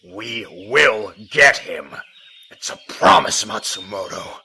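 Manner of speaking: he won't be calmed down; heated shout of a promise; angry outburst. Acted. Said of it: "Settle down, Jiro".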